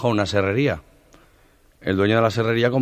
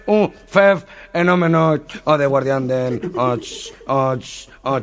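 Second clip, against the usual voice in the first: stutterer; 4 semitones higher, slower than usual. Retold or imitated. Stutterer bragging he's a phenomenon, stammering. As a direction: choke on each syllable. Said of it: "el tartamudo".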